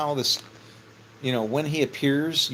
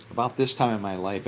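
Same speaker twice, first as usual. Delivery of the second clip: ordinary delivery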